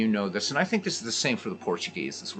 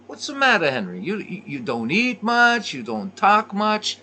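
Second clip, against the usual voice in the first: much higher, louder Italian mother voice; hammed-up Italian mom fussing over a guest; Goodfellas mother; 12 semitones higher, 5 dB louder than usual. Mother in Goodfellas to Henry Hill at the table, high fussing voice. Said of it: "and she says".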